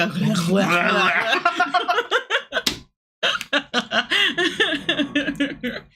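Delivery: slow, laugh-filled words. Real, not acted. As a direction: giggle through the line. Laughing while eating sour candy.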